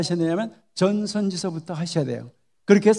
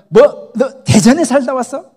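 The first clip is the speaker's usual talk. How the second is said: louder, higher astonished God voice; God's loud question; 4 semitones higher, 8 dB louder than usual. Acted. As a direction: boom it out like God surprised at a newcomer. God questions a Daejeon newcomer.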